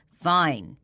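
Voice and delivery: ordinary delivery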